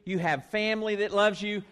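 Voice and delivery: ordinary talk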